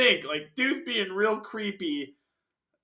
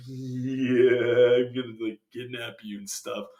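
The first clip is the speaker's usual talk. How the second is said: creepy kidnapper; 6 semitones lower than usual. Retold or imitated. Flute player plotting to kidnap her, mock creepy. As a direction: put on a mock creep's low voice. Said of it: "real creepy with his flute".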